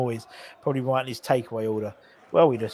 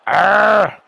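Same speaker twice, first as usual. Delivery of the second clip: short loud "arrgh" of frustration; frustrated yell; 6 semitones higher, 9 dB louder than usual. Real, not acted. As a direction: growl out loudly at a missed chance. Groans as chance is missed.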